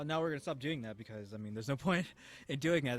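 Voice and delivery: usual speaking voice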